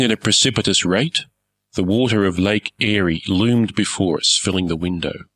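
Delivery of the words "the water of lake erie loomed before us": plain reading voice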